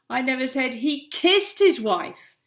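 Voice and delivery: his drawn out loud and slow; slow down and hammer his; stress on his. Shown as a demonstration. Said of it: "That is a great demonstration how, of how tone of voice completely changes a sentence".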